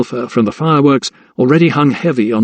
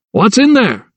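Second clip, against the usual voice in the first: high raised call over the chaos; 9 semitones higher than usual. A narrator's reading. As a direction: shout the question across the yard. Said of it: "called Thomas".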